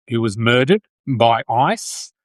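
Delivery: usual speaking voice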